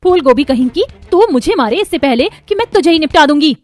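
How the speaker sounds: loud, heated outburst; worked-up, aggressive rant; angry threat. Acted. Neha attacks mother-in-law, threatening her.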